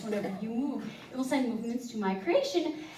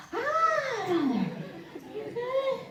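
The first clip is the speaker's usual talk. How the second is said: mock high concerned voice; 10 semitones higher, slower than usual. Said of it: "You're like".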